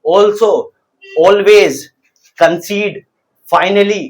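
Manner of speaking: isolated words with a pause after each; teacher's careful word-by-word drill; repeat-after-me modelling. Shown as a demonstration. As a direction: say each word distinctly, leaving room to repeat. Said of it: "मेरे साथ word को repeat करना".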